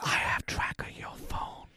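soft stalker whisper; creepy whisper. Pretend. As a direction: hiss the threat like a spy. Continues stalker joke.

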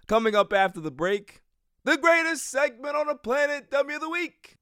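high-pitched, ringing segment announcement; big hyped tease before the break; show announcer. Acted. Host teasing the next segment after the break, hyped announcer.

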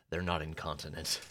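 low, soft-spoken character imitation; Castiel. Retelling his reply about Cupids, low and understated. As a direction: drop the voice and keep it quiet. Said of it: "And Castiel says".

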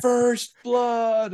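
high, loud drawn-out battle cry; imitating a buddy's victorious yell; triumphant scream. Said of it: "And then he screamed".

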